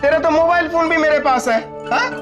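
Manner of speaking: high, taunting gloat; mock her helplessness; gloating villain. Villain gloats after cutting line.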